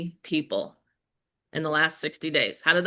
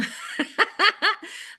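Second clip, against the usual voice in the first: a high, bright laugh bursting out; high burst of laughter; 12 semitones higher than usual. Real, not acted. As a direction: laugh at your own story, pitch up. Laughs at her own story.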